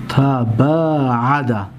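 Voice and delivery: تباعد said slowly, syllable by syllable: ta-baa-a-da; drawn-out تباعد for students to copy; slow model word. Shown as a demonstration. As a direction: model pronunciation. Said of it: "تباعد فعل ماضی است".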